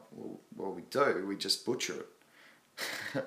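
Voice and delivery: slow, soft drawled answer; hammed-up slow Aussie mumble; mock lazy Aussie reply. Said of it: "And I".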